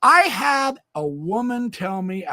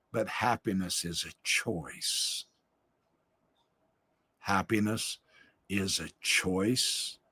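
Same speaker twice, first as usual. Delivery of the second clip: hushed advice; 11 semitones lower, 10 dB quieter than usual. Retold or imitated. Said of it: "And I told her".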